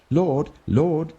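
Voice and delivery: high, urgent plea at a closed door; pleading call. A narrator's reading. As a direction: beg to be let in. Shut-out virgins plead at door.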